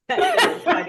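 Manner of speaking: squealing high laugh through the words; can barely get it out for laughing; real high-pitched laugh. Real, not acted. Laughing at the translation joke.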